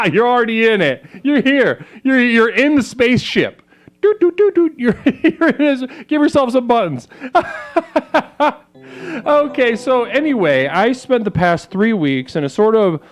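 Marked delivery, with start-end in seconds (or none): 4.0-4.7 spaceship doots. Pretend. Spaceship sound effect. 4.8-8.6 laughing. Real, not acted. Laughing at own joke.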